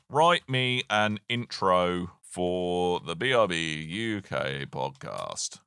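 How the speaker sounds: very slow, low and measured; slow dictation. Real, not acted. Dictating a request to chatbot.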